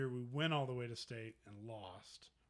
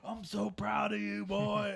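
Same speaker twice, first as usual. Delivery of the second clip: higher, louder gushing voice; proud dad impression; 7 semitones higher, 7 dB louder, slower than usual. Dad to son after football practice, acted gushing pride. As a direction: gush like a father bursting with pride.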